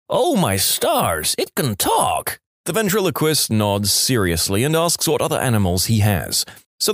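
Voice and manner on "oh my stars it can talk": high, slow astonished farmer; shocked exclamation. Acted. Farmer hears a pig talk, shocked and amazed. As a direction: act stunned, stretching the words out. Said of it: "nearly falls over in shock, exclaiming".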